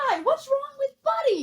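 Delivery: high, loud incredulous question; baffled protest. Real, not acted. Co-host questioning the buddy objection, loud and incredulous.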